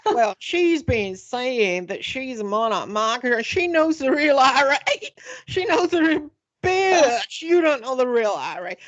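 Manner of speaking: mock the Kentucky man's Southern voice; Southern accent. Imitating the Kentucky radio host to his listeners in Southern accent. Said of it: "Do the impression".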